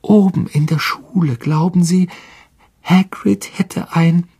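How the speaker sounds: trying for calm. A narrator's reading. A frightened boy explaining from the ground, straining to stay calm. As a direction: keep it as steady as you can, faltering mid-sentence. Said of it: "so ruhig er konnte".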